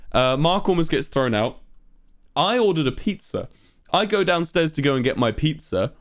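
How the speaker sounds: ordinary delivery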